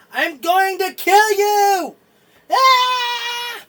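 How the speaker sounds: high, loud, drawn-out war cry; enraged battle shout. Acted. Guard charges at you with his sword, screaming threat. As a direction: shriek the threat, stretching the words, into a scream. Game master acts attacking guard.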